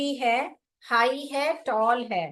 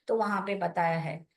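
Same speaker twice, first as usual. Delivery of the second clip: usual speaking voice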